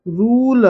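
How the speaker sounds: "रूल" with a long, held ऊ. Shown as a demonstration. Teacher showing the big ऊ vowel, "रूल" drawn out long. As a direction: draw out the ऊ in "रूल", loud and clear. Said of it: "बड़ा ऊ की मात्रा".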